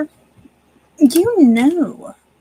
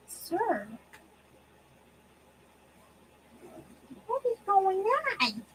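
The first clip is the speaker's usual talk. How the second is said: higher, soft questioning to the cat; 8 semitones higher, 10 dB quieter than usual. Real, not acted. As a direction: ask the cat gently what's wrong. Asking cat, high baby talk.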